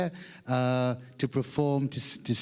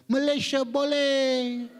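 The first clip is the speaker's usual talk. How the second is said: high, long-drawn cheer; imitated distant shout; 9 semitones higher, slower than usual. Lone audience member at the back of the hall, imitated high shout. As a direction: call it out slowly, high-pitched, from far back. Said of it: "shout out".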